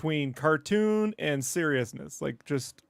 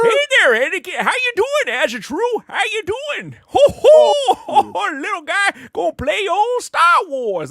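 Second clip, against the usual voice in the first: very high, loud cartoon grandpa; goofy old man voice; 8 dB louder than usual. Mocking how American voice actors do old people, high and loud. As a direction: ham up a dubbed old-timer. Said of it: "they talk like this".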